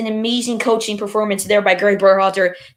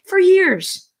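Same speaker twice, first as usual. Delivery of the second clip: emphatic outburst; 10 semitones higher, 5 dB louder than usual. Real, not acted. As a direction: punch it, up high and loud. Repeats "for years" with emphasis.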